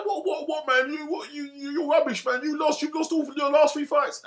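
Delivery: high-pitched mock taunt of a rival boxer. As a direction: sneer the insults like a cocky fighter.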